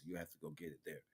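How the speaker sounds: normal talking voice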